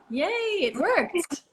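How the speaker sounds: high, drawn-out happy cheer; delighted cheer. Real, not acted. Host greeting her guest as she finally joins, delighted cheer. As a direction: cheer it slowly, really thrilled.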